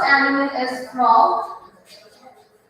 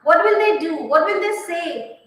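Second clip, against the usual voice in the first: normal talking voice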